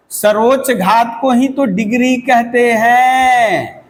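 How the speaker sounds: measured, deliberate repeat with a long-held "हैं" falling at the end. Shown as a demonstration. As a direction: stretch "सर्वोच्च घात को ही तो degree कहते हैं" out, holding the last word long. Same definition repeated slowly for emphasis.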